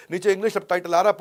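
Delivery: normal talking voice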